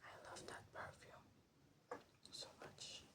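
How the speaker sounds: normal talking voice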